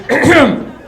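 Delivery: a pointed "ahem" cough; throat clear. Retold or imitated. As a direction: clear the throat like the man's name. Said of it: "somebody goes".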